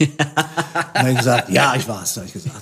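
words breaking into laughter; can't keep a straight face while telling it; giggling through the setup; laughing. Real, not acted. Confessing the camera theft, laughing.

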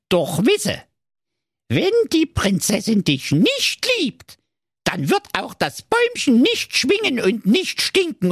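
high, cunning dwarf voice; sly dwarf. Acted. Wicked dwarf promises Marco the tree, slyly. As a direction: lure him in slyly.

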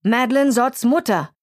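curt and emphatic; snapped back. A narrator's reading. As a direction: bark out who you are. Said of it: "blaffte sie zurück".

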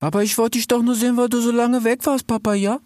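high, chatty, breezy youth; carefree young fox. Acted. Kenneth reassuring his father, high and carefree. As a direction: rattle it off cheerfully.

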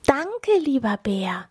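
high little animal voice; grateful animals. A narrator's reading. Animals thank the bear for snow figures, high-voiced. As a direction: raise the voice in a small thank-you. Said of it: "die anderen Tiere sagen".

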